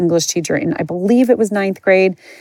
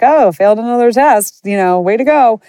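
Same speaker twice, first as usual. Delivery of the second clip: sarcastic self-mocking quip, higher and louder; breezy sarcastic cheer about failing a test; mock jokester; 5 semitones higher, 4 dB louder than usual. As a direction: brush off the failure with a joke. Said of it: "more of a jokester".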